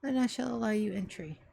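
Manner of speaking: a hint of a low, measured old butler; measured and dignified, softly pleading; stately old butler. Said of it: "think of the voice that's about to say".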